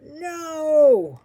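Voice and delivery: long, very loud, high shout; drawn-out full-voice yell; group yell. A narrator's reading. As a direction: bellow it in outraged refusal. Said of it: "all yelled".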